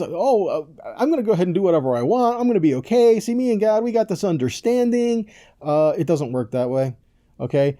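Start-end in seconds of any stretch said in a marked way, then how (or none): 0.0-5.3 higher, faster mock voice of a careless believer; mocking self-assured sinner. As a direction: sneer it like someone sure God will let anything slide. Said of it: "He says".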